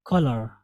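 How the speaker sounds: कलर with a short clear first vowel; कलर said as ka-lar; correct color. Shown as a demonstration. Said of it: "इसको हम पढ़ेंगे कलर".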